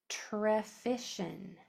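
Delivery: trefishin said very slowly, syllable by syllable; slow stretched word. Shown as a demonstration. Teacher slowly modelling trefishin for students. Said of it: "Your word is".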